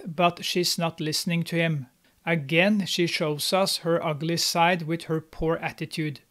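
ordinary talk, nothing marked

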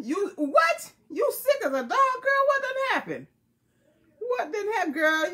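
high, squealing surprise at a friend's news; shocked concern. Real, not acted. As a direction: gasp it out like you can't believe she's sick. Viewer says she is sick.

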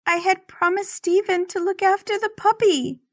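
high, cracking, near-tears plea. Acted. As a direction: let the voice break as she pleads. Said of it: "My voice cracks".

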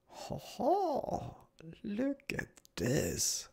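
high, soft, slow marvelling; a hushed, high-pitched wow; delighted wonder. Real, not acted. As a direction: savour the discovery slowly. Marvelling at a new game sight.

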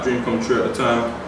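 ordinary talk, nothing marked

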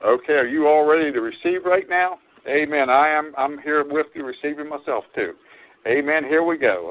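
usual speaking voice

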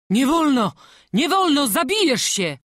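alarmed forbidding. Acted. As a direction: forbid it in alarm, each phrase pressed.

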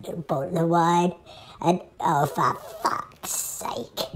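hushed whisper trailing into a slow exasperated curse; whispered aside. Acted. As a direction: drop to a whisper, then give up. Breaks down whispering.

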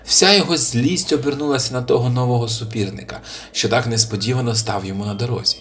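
ordinary delivery, nothing marked

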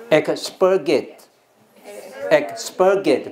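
"expurgate" slowly, as ek-SPUR-gate; stress shifted later. Shown as a demonstration. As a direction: punch the SPUR in "expurgate", drawn out. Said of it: "假使说重音不是在这边". (慢念 expurgate，重音移到 spur)

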